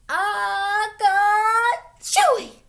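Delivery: loud, high, drawn-out mock sneeze; big storybook sneeze; acted sneeze. A narrator's reading. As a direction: build up, then burst out "achoo". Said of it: "The kitty boy sneezed".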